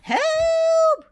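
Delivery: high panicked shriek for help. Acted. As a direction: shriek it out, terrified. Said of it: "shrieked little Miss Helpful".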